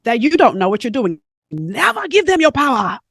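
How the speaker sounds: emphatic mock-Caribbean advice; bad Caribbean accent. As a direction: imitate a Caribbean mentor laying down the law. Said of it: "about to mess up her Caribbean accent".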